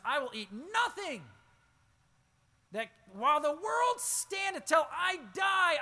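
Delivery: rising to a high, loud shout; preaching shout. Acted. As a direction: build the vow up to a full-voiced cry. Voicing Paul's vow, building.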